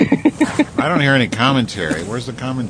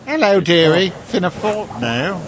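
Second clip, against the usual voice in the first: put-on British accent; 7 semitones higher than usual. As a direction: sing it like a British granny. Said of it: "I wanna hear him speak British".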